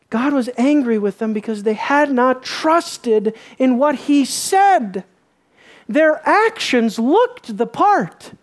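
loud, rising sermon cadence; preaching at full force. Acted. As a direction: hammer it home to the congregation. Preaching on God's anger.

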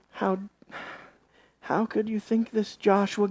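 very soft, disbelieving question; hushed reproach. Acted. God to Joshua who blames him, hushed reproach. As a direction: ask it softly, in pained disbelief.

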